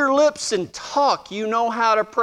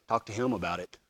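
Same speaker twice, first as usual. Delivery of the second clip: very low, hushed command; God's quiet voice; 12 dB quieter, faster than usual. Retold or imitated. God nudging a nervous man, quiet low voice. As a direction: speak softly as God, out of the blue.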